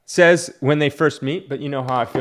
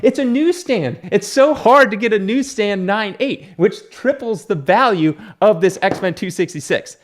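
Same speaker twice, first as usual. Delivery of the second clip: much higher, gushing collector; excited; 9 semitones higher, 4 dB louder than usual. Retold or imitated. Collector gushing about value to ignored girlfriend, excited. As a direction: gush over the rare copy. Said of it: "I'm like".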